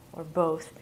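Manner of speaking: ordinary talk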